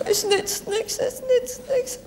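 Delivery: whimpering high and soft; crying. Acted. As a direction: sob it out in despair. Stranded, despairing prayer.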